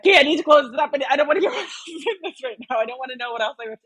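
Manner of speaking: high, giddy rush of words; retold excitement. Telling herself to close the letter, excited. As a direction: excitedly talk yourself out of peeking. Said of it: "I was so excited".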